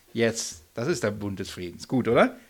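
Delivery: ordinary delivery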